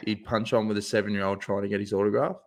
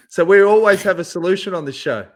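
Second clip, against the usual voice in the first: proud show brag; 6 semitones higher, 8 dB louder than usual. Real, not acted. Mock-boastful show brag.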